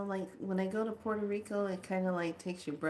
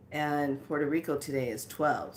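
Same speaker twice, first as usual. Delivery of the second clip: normal talking voice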